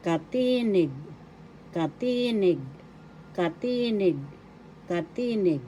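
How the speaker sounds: "katinig" with TI stretched and stressed, then falling; teacher repeating "katinig" carefully, pausing between takes; pronunciation demo. Shown as a demonstration. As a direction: lean on the middle of "katinig". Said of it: "You pronounce it as katinig".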